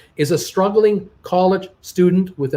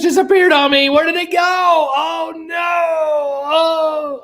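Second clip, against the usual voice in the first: panicked shout; 13 semitones higher, 3 dB louder, slower than usual. Real, not acted. Message disappeared, panics aloud.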